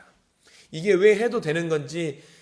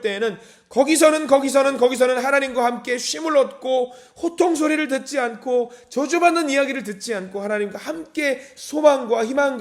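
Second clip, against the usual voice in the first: 거기서는 called out louder and higher; rising preaching fervour; 6 semitones higher, 4 dB louder than usual. Real, not acted. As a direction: preach 거기서는 with mounting force. Preacher building to climax. (거기서는을 힘차게 외치듯)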